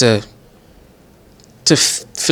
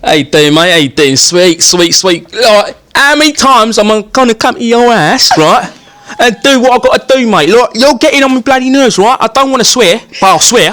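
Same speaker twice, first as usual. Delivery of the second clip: hammed-up, higher-pitched Englishman accent; English geezer accent; 9 semitones higher, 3 dB louder than usual. Comedian playing an annoyed Englishman for the host, in an English accent. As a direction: put on an irritated Englishman's accent, building higher. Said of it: "All right, the Englishman, the Englishman".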